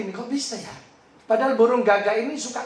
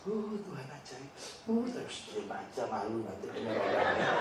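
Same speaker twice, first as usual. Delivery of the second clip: mild imitation of a wife crying, much softer; a hushed, teary hint of the wife on her first flight; tearful wife; 8 semitones lower, 12 dB quieter than usual. Preacher retelling his wife weeping on the plane, soft and tearful. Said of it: "dia nangis".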